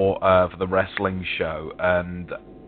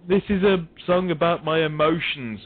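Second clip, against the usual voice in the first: mocking earnest singer; 7 semitones higher than usual. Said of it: "at this point".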